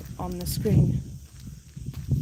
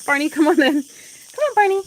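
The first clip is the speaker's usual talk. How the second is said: calling the dog; 6 semitones higher, 6 dB louder than usual. Real, not acted.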